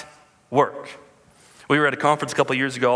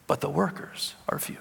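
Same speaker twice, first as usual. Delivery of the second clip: hushed; 7 dB quieter than usual. Acted. Quiet echo of the text.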